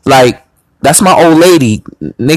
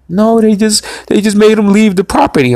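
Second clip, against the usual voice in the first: higher put-on female voice; imitated upset woman; 7 semitones higher than usual. Girl explaining what police did, mimicked higher voice. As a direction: mimic her making excuses, pitched up.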